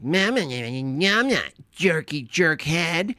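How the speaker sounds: put-on high, slow bratty kid whine; mock a pouting child calling his mom names; whiny child voice. Mocking a streamer as a whiny kid, high and slow. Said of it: "childish kind of hue to it".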